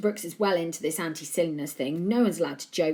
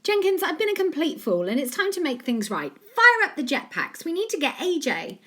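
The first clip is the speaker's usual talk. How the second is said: excited boss voice; 10 semitones higher, 5 dB louder than usual. A narrator's reading. Joe's voice in the story.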